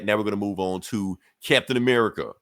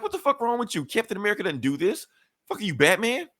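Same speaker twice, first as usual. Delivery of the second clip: high, incredulous squeaky voice; high hammed-up disbelief; mock outraged thug; 11 semitones higher than usual. Thug protests in retold scene.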